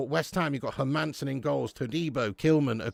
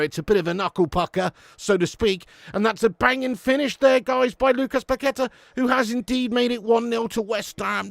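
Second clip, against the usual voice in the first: ordinary talk, nothing marked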